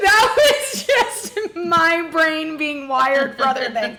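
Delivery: high, loud laughing through a slip. Real, not acted. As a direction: crack up while explaining the mistake. Cracking up at own slip.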